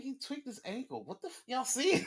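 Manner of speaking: high mock exasperated voice; mock disbelief. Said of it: "I'm like".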